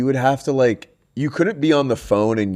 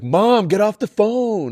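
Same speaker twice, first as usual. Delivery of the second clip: yelling to mom; 9 semitones higher, slower than usual. Retold or imitated. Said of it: "you would have to be like".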